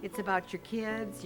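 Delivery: ordinary talk, nothing marked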